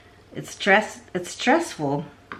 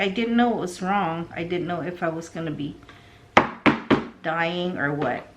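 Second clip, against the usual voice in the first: normal talking voice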